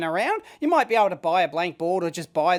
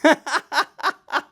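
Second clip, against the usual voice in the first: high, loud burst of laughter; helpless laugh at your own slip; cracking up; 6 semitones higher, 5 dB louder than usual. Real, not acted. Tester laughs at his botched command, amused.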